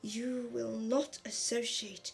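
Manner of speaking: terrible hissing villain voice, slow; Voldemort. Acted. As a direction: draw out each warning with slow menace. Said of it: "Do you like my Voldemort impression?".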